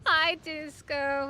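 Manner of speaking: slow, high sing-song hello; drawn-out cutesy pet greeting. Pretend. Greeting pet fish Disco.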